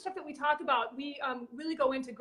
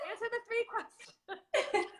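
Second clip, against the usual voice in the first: laughing reminder; 9 semitones higher, 3 dB quieter, slower than usual. Real, not acted. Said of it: "repeats the instruction laughing".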